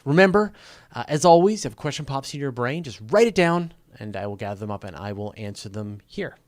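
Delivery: upbeat sign-off read to the audience; announcer outro. Acted. Host wrapping the live show for viewers, upbeat announcer read. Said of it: "I'm gonna riff an outro".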